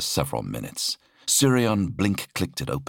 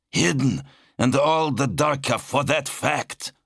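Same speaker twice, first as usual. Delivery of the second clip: high-pitched, indignant tirade; snapping angrily; 7 semitones higher than usual. A narrator's reading. Zal condemns his brother's hidden corruption, snapping. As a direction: accuse him hotly, pitch held high. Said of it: "Zal snapped".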